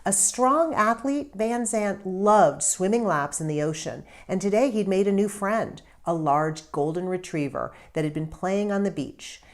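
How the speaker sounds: usual speaking voice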